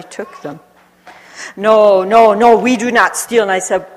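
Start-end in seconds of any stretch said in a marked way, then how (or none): 1.6-3.4 louder, pleading denial; protesting shopkeeper. Retold or imitated. Shopkeeper denying theft to her, louder protest. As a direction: insist you never stole.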